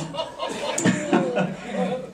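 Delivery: airless silent laugh; breathless laugh. Real, not acted. Laughing on and on at the story. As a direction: laugh without voice.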